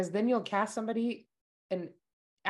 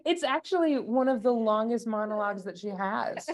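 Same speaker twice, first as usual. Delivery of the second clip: normal talking voice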